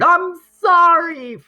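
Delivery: put-on very high, loud, drawn-out wail of a boy; hollered apology. A narrator's reading. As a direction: holler it like a locked-in kid. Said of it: "until he hollered".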